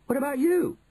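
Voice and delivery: high-pitched accusing question; indignant retort. A narrator's reading. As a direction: throw the question back, aggrieved. Bruno turns the problem back.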